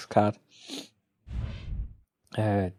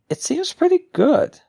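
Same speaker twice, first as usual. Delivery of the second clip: excited squeal; 4 dB louder than usual. Real, not acted. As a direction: voice jumps much higher, delighted. Card review, sudden excited reaction.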